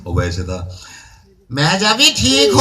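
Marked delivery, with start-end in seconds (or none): 1.5-2.6 high, slow put-on foreign caller; Qatari caller voice. Prank caller phoning the woman from Qatar, put-on voice. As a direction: stretch it out like a stranger phoning from Qatar. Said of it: "महजबी बन के phone किया".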